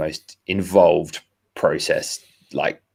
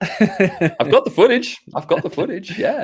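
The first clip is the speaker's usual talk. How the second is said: higher, laughing boast; laughing proudly; 12 semitones higher than usual. Real, not acted. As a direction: crow about having the footage with a laugh.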